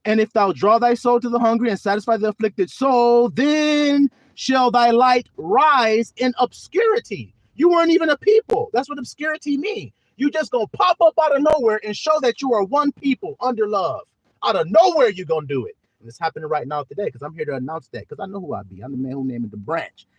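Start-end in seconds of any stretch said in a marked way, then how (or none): 0.1-7.3 high, loud and slow, each word driven home; preached scripture. Shown as a demonstration. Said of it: "there it is again". 7.6-15.1 high, loud exhortation; full-force preaching. Acted. Preacher shouting to flock.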